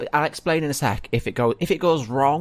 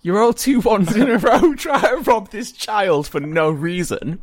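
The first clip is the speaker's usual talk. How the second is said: laughing; 9 semitones higher, 8 dB louder than usual. Real, not acted. Laughing at double failed roll.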